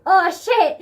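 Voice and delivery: high, loud comic wail; mock panic. Child fears dad found out, hammed-up panic. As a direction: ham up being found out.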